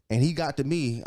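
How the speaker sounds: usual speaking voice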